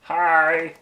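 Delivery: high, loud mimicked voice; hammed-up impression of a friend; Andy. Friend walking into a shoe store, hammed-up impression. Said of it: "here's my impression of Andy".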